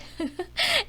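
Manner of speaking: high merry laugh; group laugh. A narrator's reading. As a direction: everyone laughing together. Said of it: "They all laughed".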